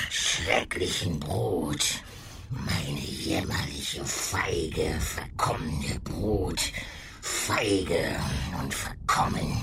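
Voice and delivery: slow, eerie creature voice; gurgling monster voice. Acted. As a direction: gurgle it like an old beast. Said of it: "gurgelnden Laut".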